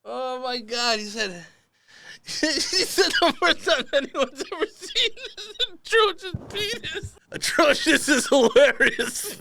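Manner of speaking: high-pitched, breaking up with laughter; cracking up. Real, not acted. Host repeating the email's atrocious phrase, laughing hard. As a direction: try to repeat it while laughing helplessly. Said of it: "I'm laughing at the word atrocious".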